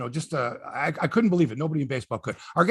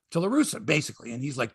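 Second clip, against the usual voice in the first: usual speaking voice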